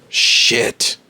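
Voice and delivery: a quick breathy whispered curse under the breath. Retold or imitated. Figure who lost his fingers, cursing in a whisper. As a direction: hiss the swear word out with no voice.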